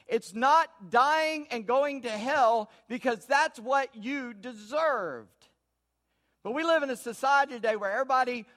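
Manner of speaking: loud, drawn-out preaching, each phrase hammered slowly; full-force preaching. Acted. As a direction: preach it out, pounding the point.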